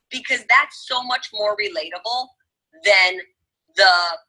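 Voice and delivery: ordinary delivery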